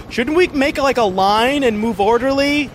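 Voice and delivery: high voiced bickering kids; fussy teenagers. Kids in the crowd bickering about a fire drill, high mocking voices. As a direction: pedantic kid then scornful reply. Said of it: "Other people are like".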